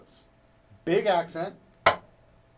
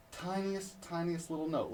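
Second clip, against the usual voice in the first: tiny and hushed; 3 semitones higher, 7 dB quieter than usual. Shown as a demonstration. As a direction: shrink the voice down to tiny. Demonstrates a tiny quiet note.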